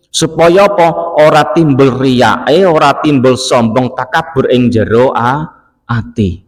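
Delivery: measured recitation and translation; kitab recitation. Acted. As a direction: stretch each phrase as if reading scripture to a congregation. Reads kitab text and translation.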